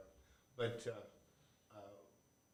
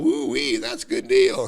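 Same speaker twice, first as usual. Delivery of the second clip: high breathy hammed-up woo-wee; mock delighted whoop; 5 dB louder, slower than usual. Oklahoma kids hearing the price, mock delighted whoop. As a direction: play the thrilled country kids.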